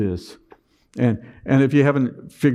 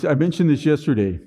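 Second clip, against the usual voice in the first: usual speaking voice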